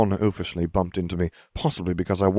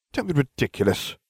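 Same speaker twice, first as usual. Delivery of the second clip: higher-pitched curt retort; snapping; 7 semitones higher than usual. A narrator's reading. Hermione rejects the jibe about her soul, snapping. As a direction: snap back, mildly cross. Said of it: "Hermione snapped".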